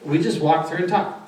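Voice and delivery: ordinary talk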